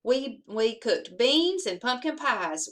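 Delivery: ordinary delivery, nothing marked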